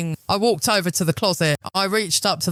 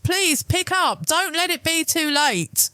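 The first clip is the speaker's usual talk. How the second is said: desperate plea; 11 semitones higher than usual. A narrator's reading. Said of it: "I prayed".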